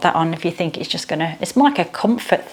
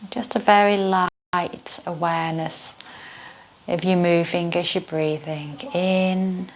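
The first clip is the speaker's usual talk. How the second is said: guided meditation. Acted. Leads yoga nidra meditation.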